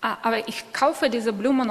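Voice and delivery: ordinary delivery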